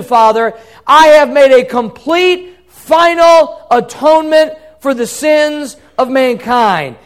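loud, high proclamation of victory; exultant, forceful preaching; triumphant cry. Acted. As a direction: declare the work accomplished, at full voice. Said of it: "it's a triumphant cry, a victory".

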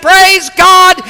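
very high, loud cry of joy; jubilant praise. Retold or imitated. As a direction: rejoice like the clapping old lady. Lady rejoices, hopping and clapping.

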